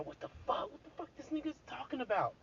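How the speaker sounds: whispered then high, fast disbelief; mock shocked listener. Mocking shocked listeners, whisper into high fast disbelief. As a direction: voice a scandalized audience. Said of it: "some people might be saying".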